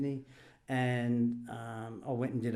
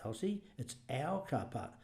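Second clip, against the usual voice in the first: firm correction; 8 dB quieter than usual. Retold or imitated. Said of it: "And I said".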